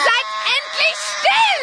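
exasperated shout; fed-up yell. Acted. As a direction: yell at them to finally hush. Yelling at crying twins.

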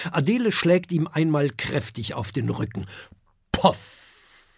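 ordinary delivery

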